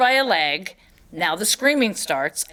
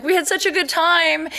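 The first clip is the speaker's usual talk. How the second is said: high, bright fake-cheery goodbye; fake cheerful goodbye; 9 semitones higher than usual. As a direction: chirp it falsely, pitched up high. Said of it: "and I'm like".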